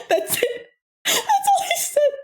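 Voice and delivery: laughing while talking. Real, not acted. Telling viewers the punchline, laughing through it. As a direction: say it while still cracking up.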